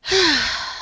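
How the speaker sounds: a long resigned sigh; mother sighs at her son's last-minute request. Real, not acted. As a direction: breathe out the weight of the task. Mom faces impossible task.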